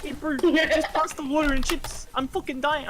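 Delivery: high, laughing mock Kiwi line. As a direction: squeak it out cracking up. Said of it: "I'm just, like, crying".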